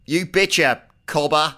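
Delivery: loud, high Australian reply; hammed-up, shouted Australian accent. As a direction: call back in broad Aussie. [name] answers in his Australian accent.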